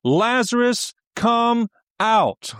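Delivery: high, loud, ringing call; preacher crying out a drawn-out summons; loud cry of command. Acted. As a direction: shout it out with authority. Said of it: "So Jesus cries out".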